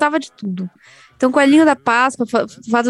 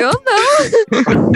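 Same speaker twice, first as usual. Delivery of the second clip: high, very slow drawn-out no; flat refusal; 10 semitones higher, much slower than usual. Retold or imitated. Child backing away from the toy, slow drawn-out refusal. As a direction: drag the refusal out.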